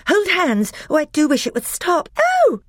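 very high, loud frightened pleas; frightened pleading. Acted. Susan begs the pulling to stop, frightened. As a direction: cry out, wishing it to stop.

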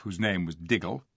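usual speaking voice

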